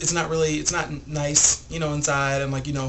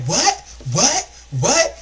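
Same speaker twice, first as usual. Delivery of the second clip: loud, high mock outrage, rising each time; sharp questioning retort, acted out; indignant disbelief; 6 semitones higher, 6 dB louder, slower than usual. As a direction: imitate an affronted woman demanding a repeat. Retold woman challenging a threat.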